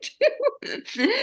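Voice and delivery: mock despair dissolving into giggles; laughing retold fear. Guest retells her summit fear while laughing. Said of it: "I was crying because I thought".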